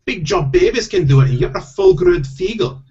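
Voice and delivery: very high, scolding Scottish wife; impatient, high stage-Scottish voice; Scottish accent. Acted. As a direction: shame him in a put-on Scottish accent. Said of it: "I do have to do Scottish accents".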